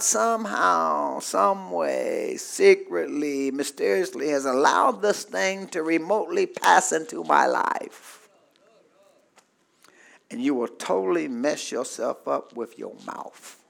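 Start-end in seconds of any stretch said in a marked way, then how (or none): 0.0-8.3 low, soft musing; secretive inner thought; hushed, mysterious. Acted. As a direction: drop low and quiet, as if sharing a secret. Voicing a secret, doubting thought.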